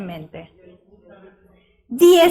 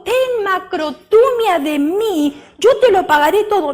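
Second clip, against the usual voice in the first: begging servant; 5 semitones higher, 4 dB louder than usual. Acted. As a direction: drag out the plea, then hurry the promise. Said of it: "le rogaba diciendo". (ruego agudo, lento y luego apresurado)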